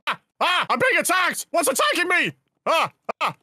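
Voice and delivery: high panicked cries; panicked yelling. Acted. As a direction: yelp in fright as if attacked. Attacked by unseen prankster.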